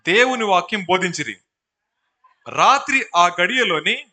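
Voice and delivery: forceful, deliberate verse reading; scripture reading. A narrator's reading. Preacher reading of the night baptism passage, loudly declaimed. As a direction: proclaim each phrase to the congregation.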